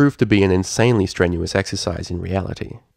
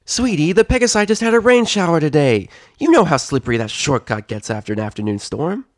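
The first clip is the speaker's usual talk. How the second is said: warning filly; 8 semitones higher, 5 dB louder than usual. Acted. Filly warns friend.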